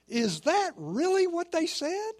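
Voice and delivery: a parent who can't believe the story; incredulous parent. Acted. Doubting parents in joke.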